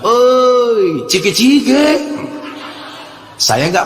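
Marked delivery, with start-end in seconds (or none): 0.0-2.0 man in hotel calls out proudly. Retold or imitated. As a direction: call out proudly to a stranger. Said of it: "Dia bilang dengan bangganya".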